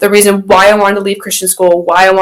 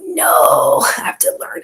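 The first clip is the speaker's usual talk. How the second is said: mock whisper; 7 dB quieter, slower than usual. Mock protest about moving home.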